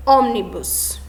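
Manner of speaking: "ônibus" as Spanish ómnibus, stress on ÓM; antepenultimate stress. Shown as a demonstration. Teacher giving an example of an esdrújula, "ônibus" as ómnibus with first-syllable stress. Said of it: "A sílaba mais forte é a antepenúltima".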